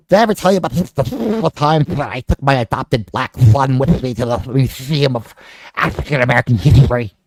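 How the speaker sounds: lightly voiced Spielberg, confiding to Sean; Steven Spielberg impression. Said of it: "This is my Steven Spielberg".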